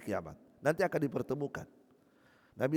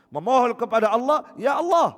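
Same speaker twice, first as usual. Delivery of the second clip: crying out to God; 7 semitones higher, 8 dB louder than usual. Acted. Ibrahim cries out to God.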